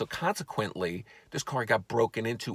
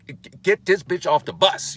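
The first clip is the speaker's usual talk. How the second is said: loud, higher, acted outrage; angry heckler shout; 8 semitones higher, 9 dB louder than usual. Passenger demanding someone be thrown off the bus, acted angry shout. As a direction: yell it like a fed-up passenger.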